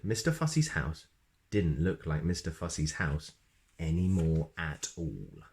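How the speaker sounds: usual speaking voice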